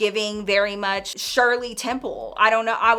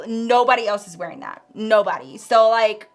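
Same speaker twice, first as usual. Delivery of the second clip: ordinary talk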